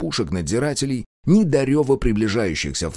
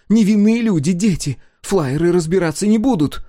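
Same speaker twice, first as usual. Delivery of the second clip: high, loud frantic plea; desperate shout; 10 semitones higher, 6 dB louder than usual. A narrator's reading. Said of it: "воскликнула Адриана".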